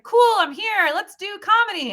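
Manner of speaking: high, loud gung-ho cheer; naive eagerness. Retold or imitated. Arriving in LA for comedy, high loud and eager. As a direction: burst in ready to take on LA. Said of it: "I was like".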